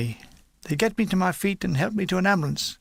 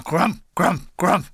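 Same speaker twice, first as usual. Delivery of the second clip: rolled, growled crump of shells; imitated mortar blasts; slower than usual. Narrator mimicking mortars landing on the hill, imitated blasts. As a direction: voice three mortar explosions.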